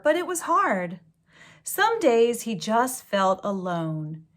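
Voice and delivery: gentle storybook read-aloud; story reading. A narrator's reading. Reading a picture book to children, storybook voice. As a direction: read it like a bedtime story to kids.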